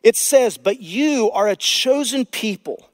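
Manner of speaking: high, loud pulpit proclamation; impassioned sermon peak; full-force preaching. Acted. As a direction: drive it home to the congregation.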